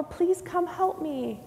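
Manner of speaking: high, slow appeal for help; calling out. Shown as a demonstration. Leader showing children how to summon help, high slow plea. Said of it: "might have to call out".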